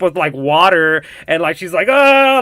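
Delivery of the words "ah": high, mock panicked "ah"; panicked cry. Girl drowning in a womb, mock panicked cry. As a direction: imitate a girl freaking out as she drowns. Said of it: "like freaking out, like drowning".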